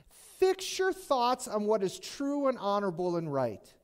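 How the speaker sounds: preacher projecting as he reads the letter aloud; scripture reading. Acted. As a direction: proclaim the verse to the congregation, phrase by phrase.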